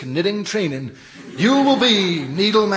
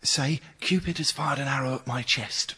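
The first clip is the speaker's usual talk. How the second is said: whispering; 6 semitones lower, 10 dB quieter than usual. Acted. Whispered prompting on phone.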